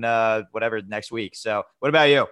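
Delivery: normal talking voice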